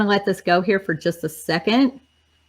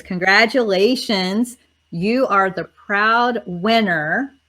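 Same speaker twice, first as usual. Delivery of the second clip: normal talking voice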